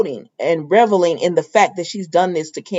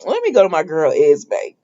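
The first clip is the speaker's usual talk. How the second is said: usual speaking voice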